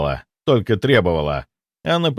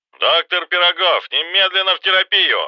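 blaring hospital intercom summons; loudspeaker announcement; 8 semitones higher than usual. A narrator's reading. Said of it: "Громкая связь взорвалась снова".

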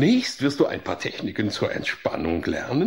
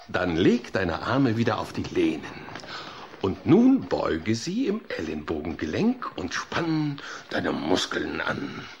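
relaxation guidance; 8 semitones lower than usual. Acted. Relaxation exercise again.

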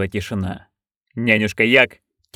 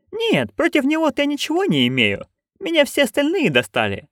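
put-on higher, eager old crone; Nanny Ogg voice. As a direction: pitch up into a gleeful old busybody. (высокий азартный голос старой ведьмы)

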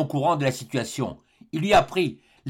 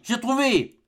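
high, exultant eureka; triumphant cry; 9 semitones higher, slower than usual. A narrator's reading. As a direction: cry out a sudden discovery, pitched high. Inventor exulting over his discovery. (cri de triomphe)

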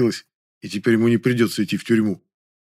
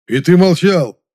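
high, loud gleeful reproach; overjoyed; 8 semitones higher, 8 dB louder, slower than usual. A narrator's reading. Men hear of more food, overjoyed. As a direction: cry out in delighted disbelief. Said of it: "обрадовались мужики".

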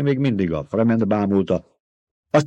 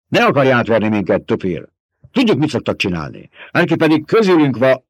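angry soldier; 6 semitones higher, 5 dB louder than usual. A narrator's reading. Csattant fel a katona.

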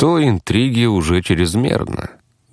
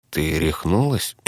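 low-key hoarse question, softer and slow; 3 semitones lower, 5 dB quieter than usual. A narrator's reading. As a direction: ask huskily, stunned out of your voice. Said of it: "хрипло спросил я".

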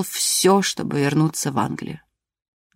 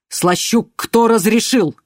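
slow, loud angry demand; stern demand; 5 semitones higher, 5 dB louder, slower than usual. A narrator's reading. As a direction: bear down on the guard. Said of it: "повторил он".

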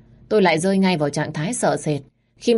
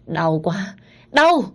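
cry of pain; 3 semitones higher, much slower than usual.

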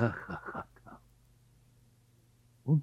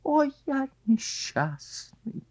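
slow lament; much slower than usual. Acted. Carp laments.

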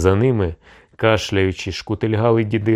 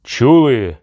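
loud, high exclaimed question; indignant call; 4 semitones higher, 6 dB louder than usual. A narrator's reading. Tsarevich appeals to his comrades, indignant loud call. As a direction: throw the question out loudly to the crowd.

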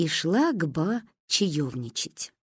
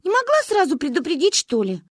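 put-on higher indignant girl's reproach; cross; 9 semitones higher than usual. A narrator's reading. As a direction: scold her friend. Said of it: "рассердилась Маня".

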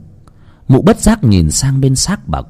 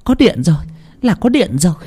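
higher, glad cry of relief; a wife, higher and overjoyed at the light; relieved joy; 8 semitones higher than usual. A narrator's reading. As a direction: burst out happily. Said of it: "Mụ Toán mừng húm".

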